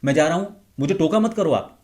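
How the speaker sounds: loud, high-toned demo of shouting at someone close by; high-pitched snap. Shown as a demonstration. A speaker showing how family members shout needlessly at close range. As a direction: bark it out high and loud at someone sitting right next to you. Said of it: "थोड़ा उसको high tone में बोलते हैं".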